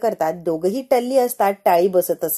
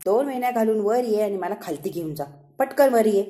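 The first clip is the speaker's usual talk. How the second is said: plain reading voice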